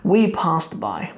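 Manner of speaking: slow, with we leaned on; slow gloss, we emphasized; stress on we. Shown as a demonstration. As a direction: put weight on the first we, then passed by. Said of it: "the na on the end is saying we were the ones".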